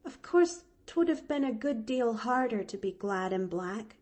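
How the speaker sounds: put-on high, eager little girl voice, a catch in the breath; a small orphan girl bravely glad; young girl Pollyanna. Orphan girl to Nancy, high and earnest.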